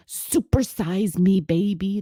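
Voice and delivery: lightly voiced greedy planet; greedy Jupiter voice. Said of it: "That's a Jupiter thing".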